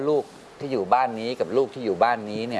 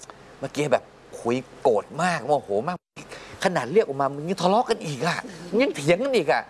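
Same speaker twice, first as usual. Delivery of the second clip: animated retelling; 7 semitones higher than usual. Retold or imitated. Father retells feelings to wife.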